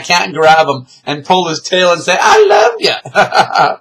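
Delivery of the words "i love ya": playful slow shout of affection; drawn-out loving yell. Pretend. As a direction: bellow it fondly and slowly. Says to cat while pulling tail.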